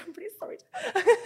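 high, fast, squeaky apologetic; hammed-up meek apology; mock meek. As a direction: gush the apology in a tiny voice. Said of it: "I'd really changed my tune".

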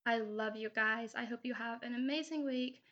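ordinary talk

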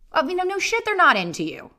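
normal talking voice

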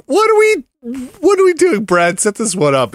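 high, loud mock disbelief; high exasperated squeal. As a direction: squeal it in fake despair.

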